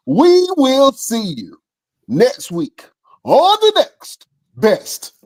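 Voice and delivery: very high, stretched-out announcer call; show sign-off. Pretend. Host cueing the guest to finish, high drawn-out sign-off. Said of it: "So I'm gonna say".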